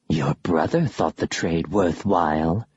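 low, slow ghostly voice; eerie whisper. Acted. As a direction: lure them in low and slow. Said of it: "one of them whispered".

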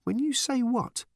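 high, demanding question; puzzled demand. A narrator's reading. Random questioning the strange bird, high and demanding. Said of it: "demanded Random".

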